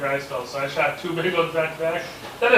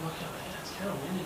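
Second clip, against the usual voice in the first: hushed whisper; 2 semitones higher, 13 dB quieter than usual. Retold or imitated. Whispering in the deer stand.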